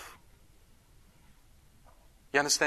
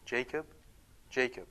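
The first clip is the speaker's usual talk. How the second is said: soft, gentle repeated calling of the name; assuring call; 3 semitones lower, 10 dB quieter than usual. Shown as a demonstration. Priest voicing God calling Jacob tenderly. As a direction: call Jacob softly, to reassure. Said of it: "Assurance and peace".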